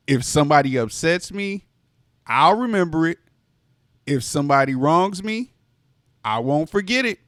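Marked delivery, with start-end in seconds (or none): none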